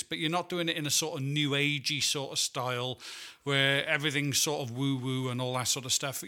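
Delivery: normal talking voice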